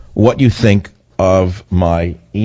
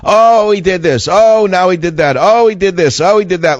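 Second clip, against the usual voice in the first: mock the moaning crybabies in a high whine; whiny moaning; 9 semitones higher than usual. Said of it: "People moaning".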